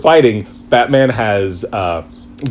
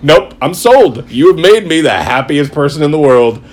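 loud, delighted declaration; thrilled fan; 3 semitones higher, 4 dB louder than usual. Retold or imitated. Fan won over by the story, loud delighted gush. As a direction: announce total joy. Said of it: "I'm like".

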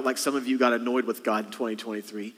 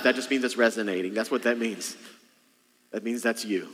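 normal talking voice

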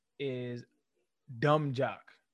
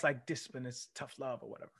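ordinary talk, nothing marked

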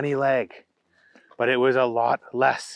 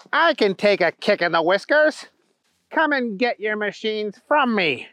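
higher-pitched cheeky bunny voice; Trent, defiant taunt; 6 semitones higher than usual. Acted. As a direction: dare the hero to come closer.

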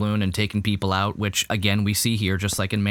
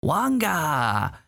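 mock Huttese; 5 semitones higher, slower than usual. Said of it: "They spoke Huttese".

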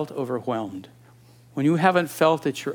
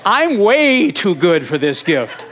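mock snooty refusal; 4 semitones higher, 7 dB louder than usual. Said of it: "Who talks like that about the gifts of God?".

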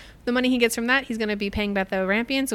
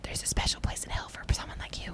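a much softer, hissed mock curse; whisper; 8 dB quieter than usual. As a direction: whisper a playful damnation.

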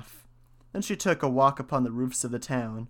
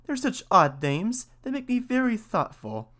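pondering mouse; 10 semitones higher than usual. A narrator's reading. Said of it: "answered the mouse".

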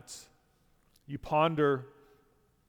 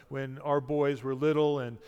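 ordinary talk

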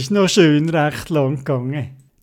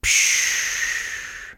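long voiceless diving-gear hiss; imitated breathing hiss; 8 dB quieter than usual. A narrator's reading. Theobald about to speak underwater, imitated airy hiss. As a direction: let out a long rushing "psch" of air.

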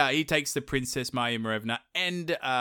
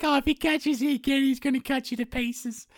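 very high squeaky damsel; princess voice; 12 semitones higher than usual. Retold or imitated. Maya Marevna scolds Ivan in a squeaky high damsel voice. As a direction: fret at the useless prince, pitched way up.

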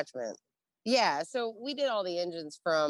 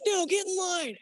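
high mocking command; mock order; 13 semitones higher than usual. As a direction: snap him into line. Marines ordering him into line.

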